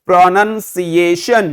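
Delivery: "प्रोनंसिएशन" slowly as pra-nan-see-AY-shan; correct pronunciation model. Shown as a demonstration. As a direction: draw out "प्रोनंसिएशन" with "see", for repeating. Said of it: "तो प्रणन बोलिए". ("प्रोनंसिएशन" धीरे: प्र-नन-सी-ए-शन)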